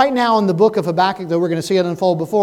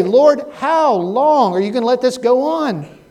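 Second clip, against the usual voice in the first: raised, pleading cry to the Lord; crying out to God; 6 semitones higher, 6 dB louder than usual. Retold or imitated. Believers pleading with God, a raised cry. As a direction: call it up to heaven, higher and louder. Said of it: "we say".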